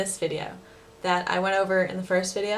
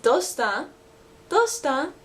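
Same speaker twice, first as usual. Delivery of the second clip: Osaka Ben "Dosta?", clipped, second time higher; Osaka dialect; 6 semitones higher, 3 dB louder, slower than usual. Shown as a demonstration. Teacher shows learners the Osaka Ben "Dosta?". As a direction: say "Dosta?" the Osaka way, short and casual. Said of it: "In Osaka, they shorten both of those to just".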